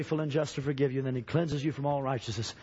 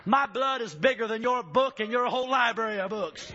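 shouting preacher; 8 semitones higher than usual. Acted. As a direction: hit the peak of the sermon.

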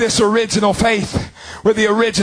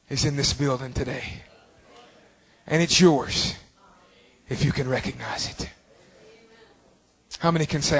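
much softer, lower, hushed invitation; quiet prayerful invitation from the pulpit; soft altar call; 6 semitones lower, 7 dB quieter than usual. Acted. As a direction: draw them in gently to prayer.

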